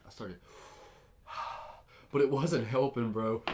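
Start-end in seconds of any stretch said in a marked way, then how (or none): 1.3-1.8 imitated heavy exhale; acted breath. Showing how they tried to breathe, heavy exhale. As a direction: blow out air as if trying to breathe.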